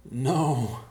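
grumbling. A narrator's reading. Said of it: "he grumbled".

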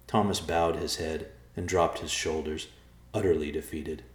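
plain reading voice

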